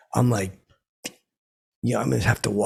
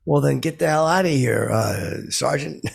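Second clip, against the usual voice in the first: loud, high mock yell; loud cocky comeback at the waiter; 5 semitones higher, 3 dB louder than usual. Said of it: "line of the century comes out of me".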